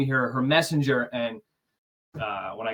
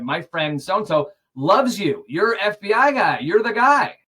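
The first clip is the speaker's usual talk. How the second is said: excited gush; 7 semitones higher, 7 dB louder than usual. Retold or imitated. Melina tells him her friend loves him, high excited gush. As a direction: gush like meeting a celebrity. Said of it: "she said".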